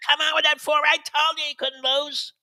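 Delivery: very high-pitched racetrack bettor; strained, high mock yell at a horse; mock yelling gambler. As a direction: imitate a bettor screaming at the track. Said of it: "you're yelling and screaming like a man".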